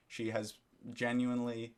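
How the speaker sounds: ordinary delivery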